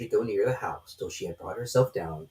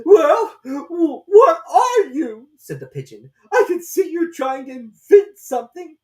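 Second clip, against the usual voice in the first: very high, loud, slow and tearful bird voice; distressed pigeon; 13 dB louder, slower than usual. A narrator's reading. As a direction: wail like a harried mother bird. Suspicious pigeon questions Alice.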